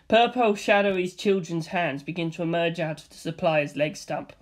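ordinary talk

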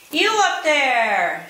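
a small one calling up the mountain, loud and slow. A narrator's reading. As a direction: shout up the slope to the one on the mountain. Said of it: "shouted Pezzettino".

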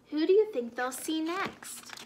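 ordinary delivery, nothing marked

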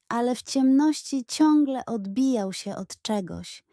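plain reading voice

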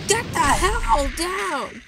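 slow, high, loud yell; frustrated yell. Real, not acted. Gamer yelling at the game to get down, slow loud yell. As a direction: drag out the yell at the screen.

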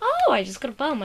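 game loot excitement; excited. Real, not acted. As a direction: blurt it out thrilled.

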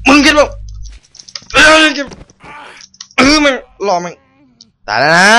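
high, loud panicked cursing; frantic furious yelling. Real, not acted. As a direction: scream curses while fighting for your life. Fighting enemy again. (ตะโกนด่าอย่างบ้าคลั่ง)

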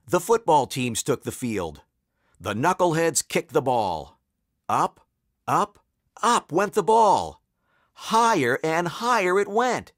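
ordinary delivery

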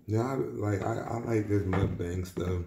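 speaking between bites; talking while chewing. Real, not acted. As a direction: mouth partly full. Seen 2: Bite taken at -1; mouth working as he talks over plate.

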